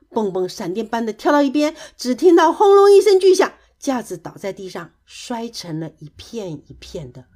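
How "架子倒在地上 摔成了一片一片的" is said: usual speaking voice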